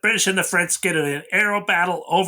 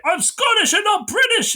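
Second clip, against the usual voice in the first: mock protest. Andre pleading with his captors, high mock yelling protest. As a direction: squeal it like a desperate prisoner. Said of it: "but then he's like".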